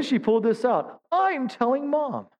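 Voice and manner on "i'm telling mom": put-on high, slow whiny kid voice; sing-song tattle like a little kid; tattling child. Little sister threatening to tell mom, high whiny kid voice.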